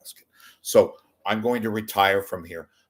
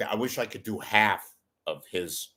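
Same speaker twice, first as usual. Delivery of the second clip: normal talking voice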